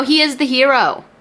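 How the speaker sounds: ordinary talk, nothing marked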